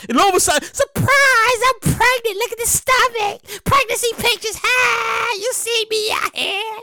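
high, incredulous, mock-outraged fan; shocked fan. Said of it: "They be like".

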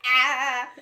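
loud mock scream of fright; retold scream. Acting out seeing a clown, mock panicked scream. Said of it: "I would be like".

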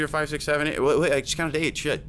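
ordinary delivery

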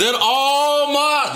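high, slow, stretched oratory; orator. Acted. Continuing the dream speech to inspire, oratorical. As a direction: proclaim grandly with long drawn vowels.

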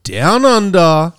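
drawn-out announcer hype; big, slow, sing-out podcast opener; show intro hype. Acted. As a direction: stretch out the words like a ring announcer. Hypes up the show's intro.

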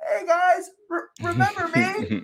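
squeaky high forgotten-guy voice; timid high voice. Retold or imitated. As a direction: pipe up, hoping to be noticed. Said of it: "He's like".

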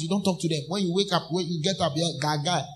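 normal talking voice

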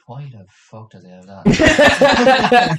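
loud, very slow, high, laughing disbelief; mock disbelief. A stranger seeing his lemonade tattoo, slow laughing disbelief. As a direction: drag it out, baffled and laughing.

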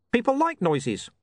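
high, chirpy little imp; bright eager gadget voice. Acted. Imp answers.

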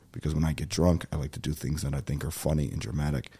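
ordinary delivery

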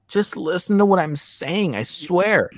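frustrated. Retold or imitated. Host pleading with evasive callers, frustrated. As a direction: imitate a host at the end of patience. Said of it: "he gets frustrated because people won't answer".

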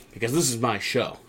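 quiet, matter-of-fact imitation of a boss; understated assertion. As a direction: lay down the claim softly. First take of Pearce's claim.

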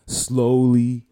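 usual speaking voice

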